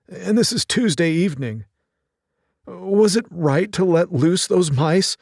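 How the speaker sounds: fretting over lost twist and time; lamenting old tailor. Acted.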